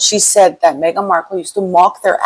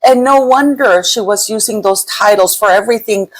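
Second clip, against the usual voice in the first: ordinary talk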